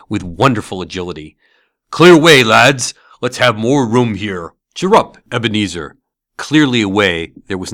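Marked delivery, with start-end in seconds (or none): 1.9-6.0 louder, hearty commanding cheer; jovial shout. A narrator's reading. Fezziwig clearing the room for the dance, hearty shout. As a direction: clear the floor with gusto. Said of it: "cried old Fezziwig".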